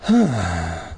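low, contented sigh. A narrator's reading. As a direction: let out a sigh of relief. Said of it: "I let out a sigh".

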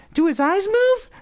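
put-on extremely high little kid; high-pitched child's question; young boy's voice. A narrator's reading. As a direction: pipe it up like a small boy.

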